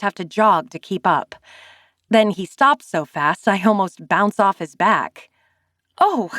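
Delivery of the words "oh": sudden high "Oh!"; startled oh. A narrator's reading. Narrator startled as Zane stops abruptly. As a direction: yelp as he stops short. Nearly bumps into him.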